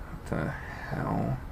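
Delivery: slow, breathless whisper. Acted. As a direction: breathe it out in dazed shock. Dazed at strange sky.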